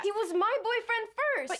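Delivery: high-pitched, indignant protest; hurt, high-voiced retort; defensive outburst. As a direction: throw it back at your mother, voice pitched right up. Heated mother-daughter quarrel.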